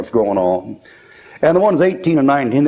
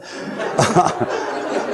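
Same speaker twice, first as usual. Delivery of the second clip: airy laugh; 4 semitones higher, 5 dB quieter than usual. Real, not acted. Laugh after joke.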